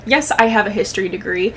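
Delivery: usual speaking voice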